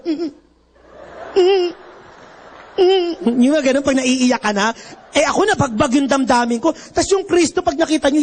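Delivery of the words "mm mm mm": mock weepy hums. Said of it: "Yung mga tao naman".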